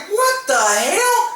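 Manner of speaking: high, drawn-out comic disbelief; slow, high-pitched exasperated amazement; incredulous. Retold or imitated. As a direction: stretch it out in baffled shock. Retells his disbelief at brawl.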